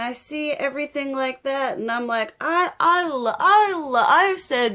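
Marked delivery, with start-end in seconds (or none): 2.4-4.7 emotional catch. Real, not acted. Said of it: "I'm gonna get emotional here".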